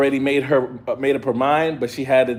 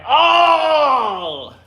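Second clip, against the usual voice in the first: shocked oh; 11 semitones higher than usual. Retold or imitated. Watching Magneto claim Rogue, shocked high shout. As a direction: gasp it out like you can't believe it.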